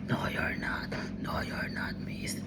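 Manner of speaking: unvoiced eerie whisper; ghostly whisper. A voice in his head whispering he missed. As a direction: breathe it like a distant spirit. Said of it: "I hear the voice".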